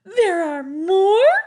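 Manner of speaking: high, loud, slow shriek of delight. A narrator's reading. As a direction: shriek it with joy. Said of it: "shrieked the clowns".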